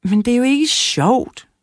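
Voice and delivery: put-on a girl protesting the boring task; whiny girl. A narrator's reading. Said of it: "sagde Lara".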